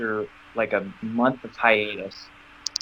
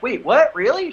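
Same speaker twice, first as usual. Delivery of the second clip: high, louder sudden surprise; shocked; 12 semitones higher, 5 dB louder than usual. Retold or imitated. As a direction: catch the news off guard. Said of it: "And I'm like".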